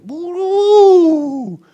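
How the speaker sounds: long high rising-and-falling "woo"; mock mesmerized woo. Imitating young kids seeing cartoons, mesmerized high woo. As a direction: imitate a kid entranced by a cartoon. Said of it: "They get mesmerized in".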